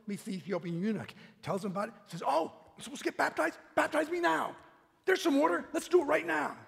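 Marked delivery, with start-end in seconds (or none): 2.3-6.6 high, loud, rushing eagerness; bursting to be baptized right now; eager convert. Retold or imitated. The eunuch urging Philip to baptize him, high and loud.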